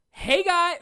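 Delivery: high, loud mock YouTuber hello; fake YouTuber greeting. Mocking the YouTuber greeting he hated, high peppy and loud. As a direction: ham up a peppy fake intro. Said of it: "I did not like going".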